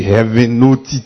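ordinary talk

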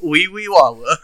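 put-on goofy joke foreign accent, laughing; mock Borat accent.

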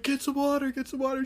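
high-pitched imitation of EMTs; urgent helpers. Acting EMTs urging water.

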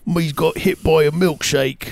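a dim Cockney, drawn out; silly Cockney voice; mock Cockney. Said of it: "it needs to be more Cockney".

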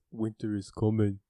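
lightly voiced solemn northern lord; a light mock of the lord's solemn motto; Ned Stark impression. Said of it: "He doesn't sound like that".

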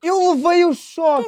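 protesto agudo e arrastado; protesto indignado. Real, not acted. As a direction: esticar as palavras, insistindo em tom agudo. Contesta acusação de não levar choque. (insistência indignada)

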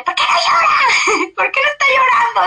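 grito muy agudo con llanto; grito desesperado. Retold or imitated. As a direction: grita de angustia por la bebé que no llora. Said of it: "yo gritando". (la madre le grita al doctor, desesperada, con llanto)

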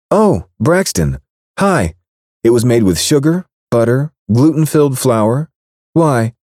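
playing dumb sweetly; coy woman's voice. Baker texting the angry rancher, coy innocent voice.

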